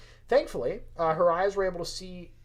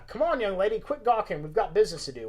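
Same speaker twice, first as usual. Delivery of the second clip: loud, raised Southern voice, no real whisper; whispered Southern urging; 5 semitones higher, 5 dB louder than usual. Acted. Old man urges staring young companion on, Southern accent. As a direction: hurry her along in a Southern accent. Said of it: "whispered John Brown".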